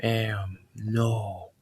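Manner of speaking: slow, drawn-out villain voice; measured, ominous self-introduction; Null. Acted. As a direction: announce yourself word by word, stretching the name. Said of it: "It's like".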